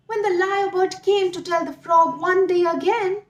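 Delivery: usual speaking voice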